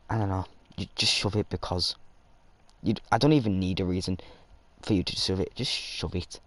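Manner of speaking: normal talking voice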